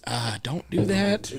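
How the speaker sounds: slow drawn-out mock caution; mock slow warning. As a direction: drag it out like a lazy warning. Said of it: "you're like".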